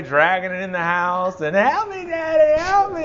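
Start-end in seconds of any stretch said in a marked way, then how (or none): 1.5-3.0 much higher, mock crying child's plea; whining little boy. Little son whining to his father while carrying groceries, high mock crying. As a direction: whimper like a small boy dragging a bag. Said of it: "he's crying the whole way".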